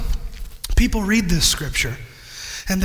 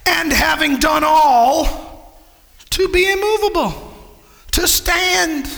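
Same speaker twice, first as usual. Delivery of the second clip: 1 preaching at full force; 6 semitones higher, slower than usual. Real, not acted. Preacher reads scripture at full force.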